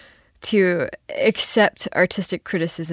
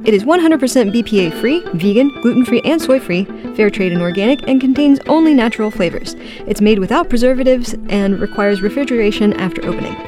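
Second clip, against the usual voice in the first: chipper 1950s ad; 3 semitones higher, 4 dB louder, faster than usual. Acted. Mock nutrition-label podcast ad.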